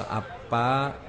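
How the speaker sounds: normal talking voice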